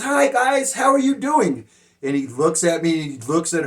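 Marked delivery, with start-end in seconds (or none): 0.0-1.6 high, loud cheery greeting; female guard. Retold or imitated. Guard greets two studying inmates, high-voiced female impression. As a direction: mimic a woman guard's bright hello, pitched way up. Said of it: "so she says".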